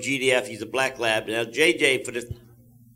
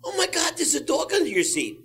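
high, sudden shock at the dog; very high startled gasp of surprise; startled shriek. Retold or imitated. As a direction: squeal it like you just spotted a dog. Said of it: "all of a sudden she goes".

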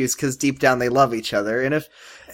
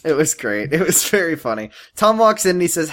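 normal talking voice

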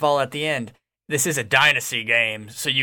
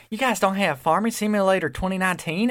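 put-on kid voice; 7 semitones higher than usual. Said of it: "he's like".

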